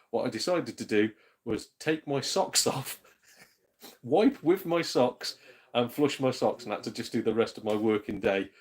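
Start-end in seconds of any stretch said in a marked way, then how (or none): none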